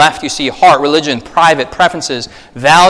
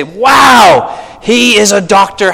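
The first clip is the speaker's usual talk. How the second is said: mock impressed exclaim; 7 semitones higher, 5 dB louder, much slower than usual. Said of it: "In Korea, they say".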